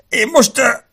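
high, loud, breaking-off groan; pained stammer. A narrator's reading. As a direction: try to speak through the pain. Wounded man about to faint. (kínlódó, elakadó, magas hang)

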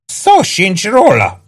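high, drawn-out refusal, lightly acted panting; panted refusal. A narrator's reading. Maggio refuses while fighting, a high slow protest. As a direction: refuse in a high voice, stretched slowly. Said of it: "lihegte Maggio".

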